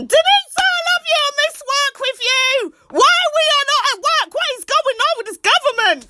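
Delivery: very high, loud calling to Denise; excited shout-out. Real, not acted. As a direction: call out across the room. Calling out to friend.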